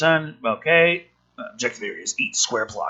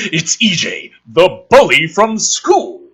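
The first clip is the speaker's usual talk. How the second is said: ordinary talk, nothing marked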